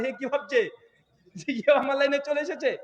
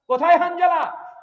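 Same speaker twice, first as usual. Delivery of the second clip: "কোথায়" shouted loud and high, falling at the end; frantic shout; 7 semitones higher, 8 dB louder than usual. Retold or imitated. Companion searching for Hanzala, loud frantic shout. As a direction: shout "কোথায় Hanzala" in alarm. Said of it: "চিৎকার দিয়ে বলছে".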